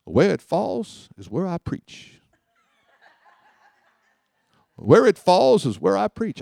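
slow preacher intoning "Where it falls is where I preach"; a slow, weighty preacher line. Retold or imitated. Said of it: "He says".

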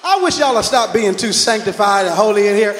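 teasing shout. Real, not acted. Preacher teasing the congregation.

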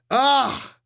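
high, loud pained shriek. A narrator's reading. Vampire shrieking when hit by Fanny. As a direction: shriek as you are struck. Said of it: "shrieked the vampire".

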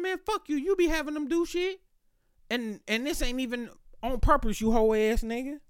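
very high, sharp cussing-out; angry outburst. Retold or imitated. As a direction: go off on him at the top of your voice. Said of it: "I could talk to him like that now".

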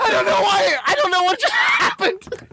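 high-pitched wail breaking into laughter; laughing bewilderment. Retold or imitated. Comedian confessing audition blunder to friends, laughing bewilderment. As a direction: protest helplessly, cracking up. Reliving the botched audition, cracking up.

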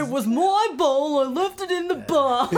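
high, loud, drawn-out mimicry; hammed-up impression of a bandmate; Michael impression. Performer showing off the bandmate impression, exaggerated and loud. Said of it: "who does the, uh, Michael impression".

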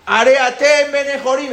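high, loud, slow cry; shouting. Acted. Pharaoh begins proclaiming their freedom, shouting. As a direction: cry it out for all to hear. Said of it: "Empezó a gritar".

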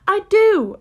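high, loud, hasty retort; hasty reply. A narrator's reading. Alice defending herself to the March Hare, hasty. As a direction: jump in defensively. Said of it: "Alice hastily replied".

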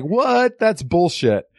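high, incredulous outburst; mock disbelief. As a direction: squeal it in disbelief. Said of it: "You were like".

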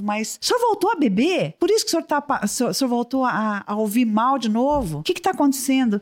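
high-pitched imitation of a doctor's yell; mock shouting. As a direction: retell a shouted scolding without fully yelling. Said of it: "o médico gritou de novo".

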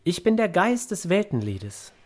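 feierliche, höhere Geisterstimme; Geist des Weltenliedes. Acted. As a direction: stell dich würdevoll als Geist vor. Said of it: "Sie verbeugt sich und sagt". (förmliche Vorstellung eines Geistes, hoch angesetzt)

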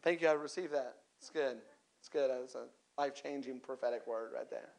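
low, understated reply; mock acceptance. Churchgoer receiving a life-changing prophetic word, mock-grateful and low.

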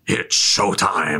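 slow, lightly voiced villain line; Thanos voice. Ending the mimicked trailer, slow villain drawl. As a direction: drag it out like a smug villain. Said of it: "Just the Thanos voice".

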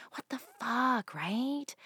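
ordinary talk

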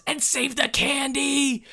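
put-on high, loud, drawn-out kid cry; child's yell. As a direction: yell it slowly like an overexcited child. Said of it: "one of them yells".

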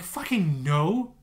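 hammed-up annoyed denial; imitating wife's retort. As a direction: mimic his wife's exasperated no. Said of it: "my wife's like".

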